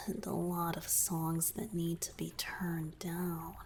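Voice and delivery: soft breathy whisper close to the ear. Acted. Merman confiding to his savior in a whisper. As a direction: whisper it like a confession.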